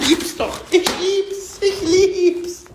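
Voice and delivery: high, gushing delight; delighted squeal. Real, not acted. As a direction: gush up high over it. Delivery of favourite drink.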